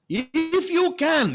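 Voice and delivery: "If you can" drawn out slowly, pitched high and loud; stressed if you can. Shown as a demonstration. Preacher spotlighting the father's doubt, slow stress. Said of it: "And you notice this".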